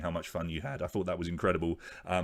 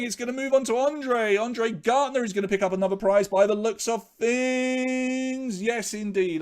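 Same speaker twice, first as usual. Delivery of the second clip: much higher and louder, excited race-caller build; live wheel commentary; 13 semitones higher, 8 dB louder than usual. Acted. Host calls the prize wheel for his viewers, excited commentary. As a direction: call every name like a horse race.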